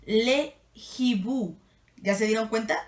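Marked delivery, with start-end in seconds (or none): 0.1-1.6 "le hibou" slow, with a clear break before "hibou"; French pronunciation. Shown as a demonstration. Teacher showing the aspirated h in "hibou". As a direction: hold "le" apart from "hibou" slowly. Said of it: "les va a aparecer así".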